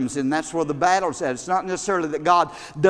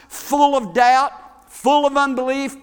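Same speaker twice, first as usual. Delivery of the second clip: ordinary talk, nothing marked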